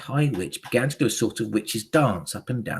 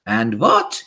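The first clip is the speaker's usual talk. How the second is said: loud, commanding witch; shouted witch question; Grand High Witch; 6 dB louder than usual. A narrator's reading. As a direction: call it out to the crowd as a witch. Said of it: "shouted the Grand High Witch".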